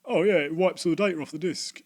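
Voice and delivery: hammed-up know-it-all shop kid; mocking the kid. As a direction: parrot his claim smugly. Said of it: "I just let the kid go".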